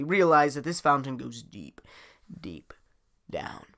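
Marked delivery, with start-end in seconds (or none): none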